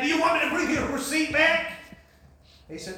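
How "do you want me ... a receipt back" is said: raised, loud, angry questioning; mad demand. Retold or imitated. Dale pushing back at pastor's kindness, mad demand. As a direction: throw the question at him, high and hot. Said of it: "You know, I get all mad".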